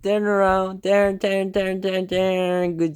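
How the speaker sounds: high, sing-song repeated commands; high coaxing to Diego; pet training talk. Real, not acted. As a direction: coax the bird round and round. Training the parrot.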